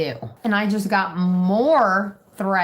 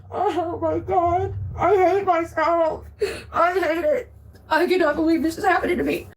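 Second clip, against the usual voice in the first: higher, slow, real sobbing words; crying; 6 semitones higher, slower than usual. Real, not acted. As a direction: break down in tears over it. Sobbing over her arrest.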